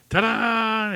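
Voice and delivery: high, loud, drawn-out ta-da; triumphant reveal. Acted. Clown springs the punchline on the boy, mock triumphant. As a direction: sing it out like a magician's flourish.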